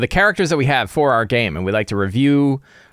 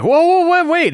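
much higher, louder mock panic; alarmed players; 6 dB louder than usual. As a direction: squeal it like players seeing disaster. Players panic as fiend attacks.

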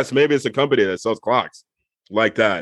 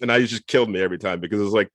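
ordinary talk, nothing marked

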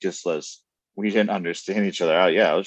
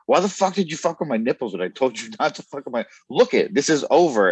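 angry outburst; 6 semitones higher than usual. Retold or imitated. Man retelling how he got so mad at her, angry outburst. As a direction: blow up in a re-enacted rant, raised pitch and volume. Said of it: "And I got so mad".

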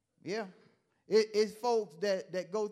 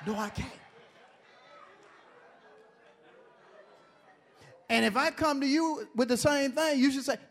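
high, loud preacher climax; preaching at full force; 7 semitones higher, 7 dB louder than usual. Acted. Pastor preaching to his congregation, loud and high. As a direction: drive it home, call and response.

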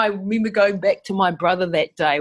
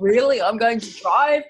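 higher-pitched mock amazement, grinning; delighted disbelief; 4 semitones higher than usual. Mum grinning at being told to drive, delighted disbelief. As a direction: imitate a thrilled, incredulous learner. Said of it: "grinning from ear to ear".

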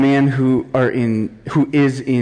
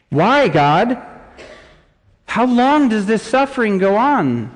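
anguished cry to God; 10 semitones higher than usual. Acted. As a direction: build from plea to full-throated high cry. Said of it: "This is what David is saying".

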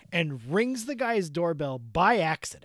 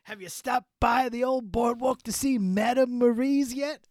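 higher, chummy Jersey rock-star voice; 4 semitones higher than usual. Pretend. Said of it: "I'm in character. I'm doing a whole voice".

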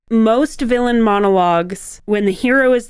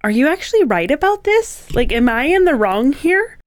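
higher-pitched, questioning self-doubt; doubting hero; 6 semitones higher than usual. Acted. Hero pausing to question himself before the villain, doubtful. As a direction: pause and wonder, pitch swinging upward. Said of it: "make the hero pause and go".

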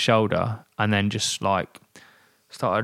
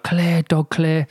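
shouted warning; 7 semitones higher, slower than usual. Retold or imitated. Rider shouting a dog warning to his cycling team. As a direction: call it out to the squad. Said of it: "I shouted".

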